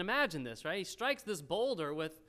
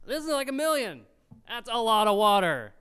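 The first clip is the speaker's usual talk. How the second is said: loud, raised, astonished outburst; amazed exclamation; 5 semitones higher, 11 dB louder than usual. Real, not acted. Preacher sets his household against a million people, amazed. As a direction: belt it out in amazement.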